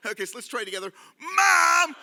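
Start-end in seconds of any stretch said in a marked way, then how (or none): 1.2-2.0 loud, high mock cry for Mom; hammed-up shout to mother; call for help. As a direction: yell like a tattling child. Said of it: "we're gonna call for help".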